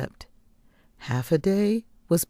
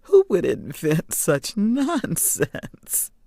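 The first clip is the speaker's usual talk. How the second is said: gasping with laughter; 5 semitones higher, slower than usual. Acted. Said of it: "Gasping for breath".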